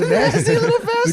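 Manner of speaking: high, cackling mock outrage; laughing insult. Real, not acted. Laughing at friend's reply.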